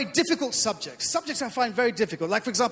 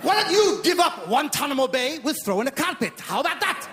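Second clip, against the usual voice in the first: higher, worked-up Iranian haggler; Iranian accent; 4 semitones higher than usual. Acted. Iranians raising the offer to America, excited Iranian accent. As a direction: push the bargain harder in broad Iranian English. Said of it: "The Iranians say".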